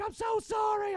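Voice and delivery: high, loud shouted apology; screamed apology. Retold or imitated. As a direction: yell the apology after him. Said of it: "I'm, like, screaming at Ty".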